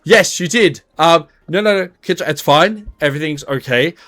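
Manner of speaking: high, laughing fluster; nervous Bong. Acted. Bong nervously laughing it off to Kidra. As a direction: cover it up nervously.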